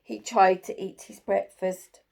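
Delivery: usual speaking voice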